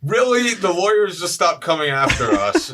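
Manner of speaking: high-pitched, exasperated protest; indignant, playing it up; mock outrage. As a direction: protest in exaggerated disbelief. Mock outrage at Pacino remark.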